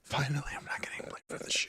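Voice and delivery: whispered aside under breath; whispering. Real, not acted. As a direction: whisper it, half to yourself. Aside while stream restarts.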